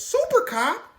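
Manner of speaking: loud, very high incredulous question; shocked disbelief. Retold or imitated. Everybody reacting to the snitch's accusation, loudly incredulous. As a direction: exclaim it in hammed-up astonishment.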